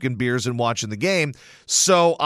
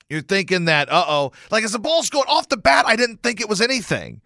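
normal talking voice

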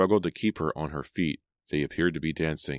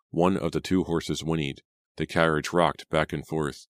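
plain reading voice

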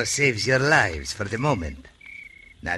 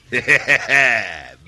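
loud, knowing villain's laugh; sly chuckle; 3 semitones higher, 7 dB louder than usual. Acted. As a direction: chuckle as if sealing a crooked bargain. Villain pleased with the deal.

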